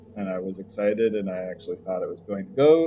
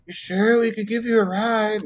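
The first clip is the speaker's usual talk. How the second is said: high-pitched fussing mother impression; mock mother; 12 semitones higher, 5 dB louder than usual. Mom offers the speaker a ride, in a high mock-mother voice. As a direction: put on a much higher, louder mom voice.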